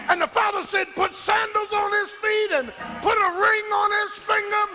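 high, ringing cries building to a climax; full-force preaching. Real, not acted. Preacher telling the prodigal's welcome home, full-force preaching.

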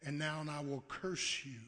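soft, grave pronouncement; God's curse spoken softly; quiet curse. Acted. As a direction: hush it like a verdict.

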